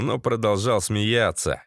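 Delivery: ordinary delivery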